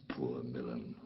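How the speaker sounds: hushed lament for the lost city; grieving whisper. Acted. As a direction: let the name fall to a whisper. Prospero laments his lost dukedom.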